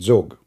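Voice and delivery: Zog repeated Egyptian-style with a hard g; Egyptian pronunciation. Shown as a demonstration. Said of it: "this is in the Egyptian accent".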